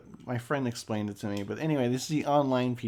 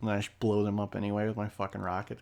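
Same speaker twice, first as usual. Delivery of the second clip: ordinary delivery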